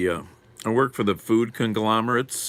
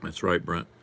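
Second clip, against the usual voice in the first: ordinary delivery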